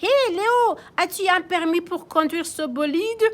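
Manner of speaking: high loud police chief; police chief calling out. Acted. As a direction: call out from the pile. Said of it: "demande le chef de police". (chef de police qui interpelle fort)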